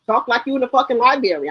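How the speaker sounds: ordinary talk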